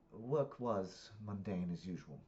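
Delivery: put-on slow, measured UK-accented reply; British accent. Parent answering daughter about work, slow British accent. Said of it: "I speak in the accents 'cause it's U K".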